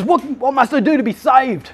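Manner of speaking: high pleading question in a voice unlike his own; desperate jailer. Retold or imitated. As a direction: beg like a shaken jailer. Jailer on his knees begging.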